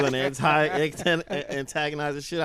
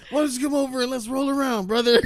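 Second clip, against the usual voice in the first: loud high wrestler voice; wrestling promo; 13 semitones higher, 8 dB louder than usual. Retold or imitated. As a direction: yell it like a pro wrestler. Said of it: "It's like your wrestling voice".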